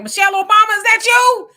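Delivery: high, loud hammed-up holler; mock calling out. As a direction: pretend to shout in astonished recognition. Jokingly calling out to a recognized person.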